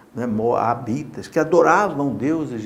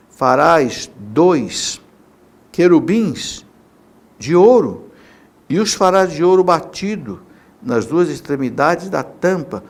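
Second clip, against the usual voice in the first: scripture reading; 7 dB louder than usual. Shown as a demonstration. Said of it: "eu vou ler pra você".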